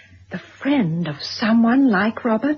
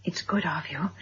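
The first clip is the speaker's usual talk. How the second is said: whispered thanks; 4 semitones lower, 9 dB quieter than usual. Acted. Tense visit, grateful aside.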